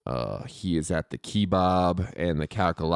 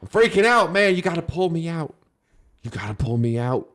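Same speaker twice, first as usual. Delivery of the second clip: panicked and nervous; 7 semitones higher than usual. Acted. Nervous informant.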